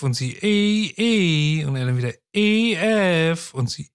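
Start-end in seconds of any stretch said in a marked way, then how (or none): 0.4-1.6 calling out. Acted. Wife calling back from dock. 2.3-3.5 calling out. Acted. Sailor calling to wife.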